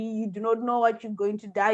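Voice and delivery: ordinary talk, nothing marked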